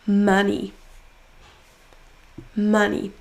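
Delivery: MON in "Money" hit firmly; stressed syllable emphasized. Shown as a demonstration. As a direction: lean on the first syllable of "Money". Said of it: "en prononçant plus fort la syllabe". (première syllabe de « Money » appuyée)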